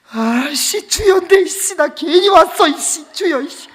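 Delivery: high, loud, griping prayer; worn-out grumbling. Retold or imitated. Tired kid regretting coming, loud mock grumble. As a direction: imitate a kid cursing through the chant. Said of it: "힘든 거요, 이게 이제".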